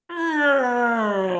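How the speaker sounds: high, drawn-out "ahh" sliding down; mock screech like nails on a chalkboard. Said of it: "on the chalkboard".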